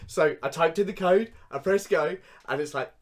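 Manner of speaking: normal talking voice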